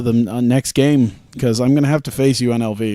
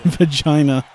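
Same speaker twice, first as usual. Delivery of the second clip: mock Australian accent; 3 semitones higher than usual. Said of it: "because of, like, Australian".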